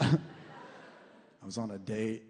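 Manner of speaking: ordinary talk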